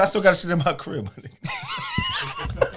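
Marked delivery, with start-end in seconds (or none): 1.1-2.7 high, slow, cracking into laughter; stretched laughing squeal. Real, not acted. Laughing at his own fake-watch story, squealing it out high. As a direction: squeal it out as the laugh takes over.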